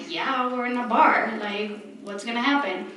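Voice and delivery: ordinary talk, nothing marked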